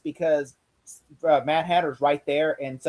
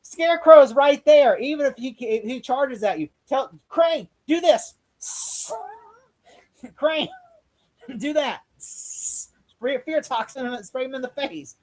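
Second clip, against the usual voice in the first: high, slow exasperated telling-off; exasperated, coaching the villains from the couch; mock exasperated advice; 8 semitones higher than usual. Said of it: "And I'm like".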